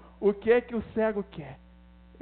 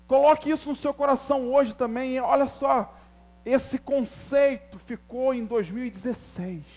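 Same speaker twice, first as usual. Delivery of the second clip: raised, higher-pitched sermon voice; impassioned preaching; 5 semitones higher, 5 dB louder than usual. Real, not acted. Preacher urges congregation to leave last year behind, forceful preaching. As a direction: drive each declaration home with force.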